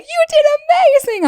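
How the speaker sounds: very high, loud squeal of congratulation; squealed mock congratulations; gushing praise. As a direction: imitate an over-excited gusher. Imitating gushing people after reveal.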